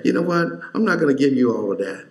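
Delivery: rattled off fast; tossed off quickly; fast hypothetical aside; mock quote. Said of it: "He didn't say".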